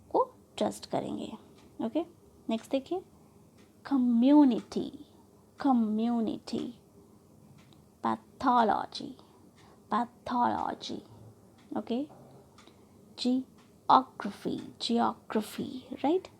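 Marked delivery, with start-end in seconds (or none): 3.8-6.7 community with MYU stressed; third-last syllable stressed. Shown as a demonstration. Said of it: "third वाली syllable from the end". 8.0-11.0 pathology slow, with THO stressed; short 'पै', then lean on 'थो' in pathology; third-last syllable stressed. Shown as a demonstration. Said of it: "third वाली syllable from the end". 13.2-15.9 geography with OG stressed; third-last syllable stressed. Shown as a demonstration. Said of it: "third वाली syllable from the end".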